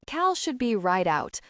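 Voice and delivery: ordinary delivery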